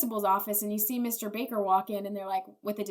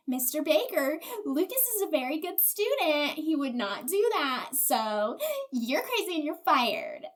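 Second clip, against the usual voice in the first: higher-pitched, emphatic imitation of disapproval; mock prim principal; 5 semitones higher than usual. Principal firing Mister Baker, mock indignant. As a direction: scold primly, as a caricature. Principal defends Lucas, fires teacher.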